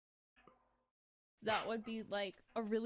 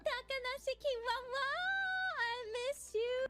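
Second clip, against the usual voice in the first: much higher squealing cry; imitating Kiara. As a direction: squeal like the idol.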